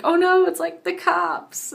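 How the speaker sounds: high, loud, slow panic; panicked cry. Retold or imitated. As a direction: cry out in alarm. Police arriving in dream.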